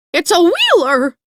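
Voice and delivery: high, loud terrified cry; frightened shriek. A narrator's reading. Fleeing hen warns Dorothy, loud frightened shriek. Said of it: "fluttering away in great fright".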